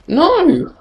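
sudden high yelled "No!"; frustrated shout. Real, not acted. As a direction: cry out as you get killed. Dying in the game.

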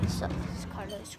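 whispered intro in the store. Real, not acted. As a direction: hush it under your breath.

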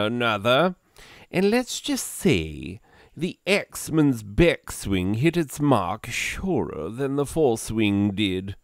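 theatrical posh British storyteller; upper-class gentleman's accent. Acted. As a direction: narrate it as a pompous English gentleman accent. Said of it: "upper-class gentleman's accent".

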